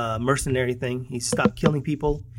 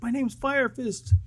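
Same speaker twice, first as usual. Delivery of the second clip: higher, slow mock-kid voice; kid insisting on his name; 10 semitones higher, 6 dB quieter, slower than usual. Retelling the mutant kid correcting the cops, mock-kid voice. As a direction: imitate a proud kid, pitch up and drawn out. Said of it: "and he's like".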